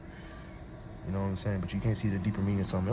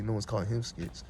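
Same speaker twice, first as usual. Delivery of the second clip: ordinary talk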